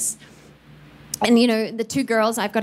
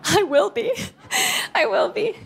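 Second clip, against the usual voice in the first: high, laughing reply; real teary laugh, much higher; laughing through tears; 10 semitones higher than usual. Real, not acted. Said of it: "Are you okay, Mom?".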